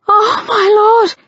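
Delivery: high, loud cry of pleasure; moaned cry. A narrator's reading. Lady crying out as Harry takes her, loud moan. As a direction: cry out at the peak. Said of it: "she moaned".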